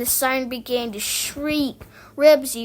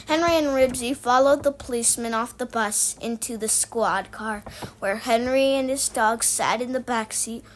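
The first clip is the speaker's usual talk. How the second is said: usual speaking voice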